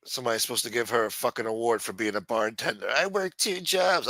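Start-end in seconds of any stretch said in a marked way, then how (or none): 2.9-4.1 mocking quote. Mocking a bartender's boast.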